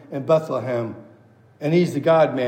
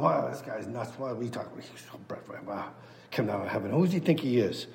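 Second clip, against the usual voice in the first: hushed, breathy aside among themselves; mock grumbling whisper; 6 semitones lower, 11 dB quieter, faster than usual. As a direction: whisper it like gossiping behind his back. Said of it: "They're off by themselves going".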